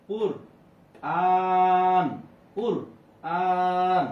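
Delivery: কুরআন loud, with আন held very long; three-alif elongation. Shown as a demonstration. Teacher showing the rule at a pause, কুরআন drawn out long. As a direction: stretch the আন of কুরআন on a level note. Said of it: "তিন আলিফ টানিয়ে পড়বো".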